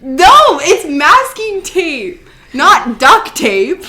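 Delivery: loud, high, drawn-out objection; emphatic, high-pitched correction; loud protest. Acted. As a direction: slowly belt out each word in protest. Protesting a worry about the walls.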